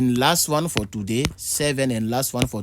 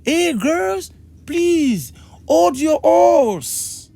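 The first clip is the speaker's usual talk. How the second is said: high, loud, drawn-out call; slow, high-pitched acted shout; acted plea to a shouting wife; 14 semitones higher, 6 dB louder, much slower than usual. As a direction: plead loudly, stretching "hold your horse". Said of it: "tu causes avec ta femme et puis elle crie sur toi".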